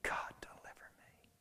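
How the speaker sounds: broken, breathy whispered plea; anguished whisper. Acted. Peter at the wall begging God, whispered anguish. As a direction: whisper it through tears. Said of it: "with his face pressed against the wall saying".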